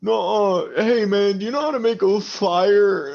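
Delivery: put-on high, slurring drunk arguing with his buddy; drunk quarrel. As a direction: snap at your drunk friend to calm down. Drunk Jacob asks player.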